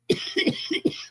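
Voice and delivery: high, strained wheezing cough; real coughing fit. Real, not acted. Smoker coughing fit on stream.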